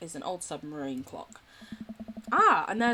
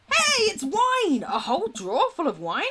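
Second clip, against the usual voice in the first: excited discovery; 7 semitones higher, 6 dB louder than usual. Acted. Finds the wine in game.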